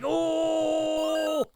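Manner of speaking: high, strained ape howl; angry ape. Retold or imitated. Gamer voicing the angry Donkey Kong on screen, high mock howl. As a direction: howl like an ape fed up with being made a fool. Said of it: "Donkey Kong looks really pissed off".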